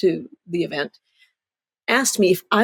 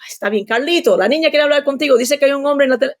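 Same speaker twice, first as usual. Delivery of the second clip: higher, accented mock mother's complaint; scolding mother; 7 semitones higher, 3 dB louder, faster than usual. Daughter retelling her mother's phone complaint, higher mock scolding voice.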